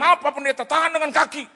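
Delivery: high, loud retold plea; urgent pleading shout. Driver asking pastor to stop the bike, panicky shout. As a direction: shout the instruction in mock panic. Driver begging passenger to brake.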